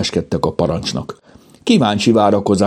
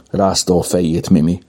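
usual speaking voice

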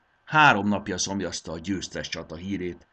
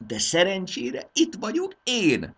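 high, loud, slow and delighted; king's self-praise; 13 semitones higher, 5 dB louder, slower than usual. Acted. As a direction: beam as you correct him to yourself. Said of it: "folytatta üdvözölt arccal a király".

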